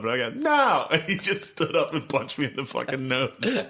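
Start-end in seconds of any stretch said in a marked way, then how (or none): 0.4-0.9 high, sudden yell; mock defiant refusal. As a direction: shout it like a stubborn kid. Retold refusal to father.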